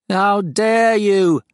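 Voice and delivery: high, loud, very slow alien demand; outraged creature demand. Acted. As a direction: stretch each word out in affront. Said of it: "demanded the farm".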